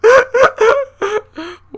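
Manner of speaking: shrill nervous laugh of relief; high panicked laugh. Real, not acted. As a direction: laugh out the fright in a high burst. After escaping the monster.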